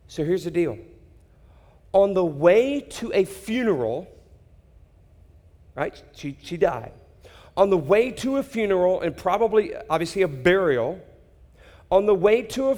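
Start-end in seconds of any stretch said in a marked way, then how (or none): none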